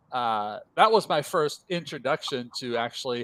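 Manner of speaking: normal talking voice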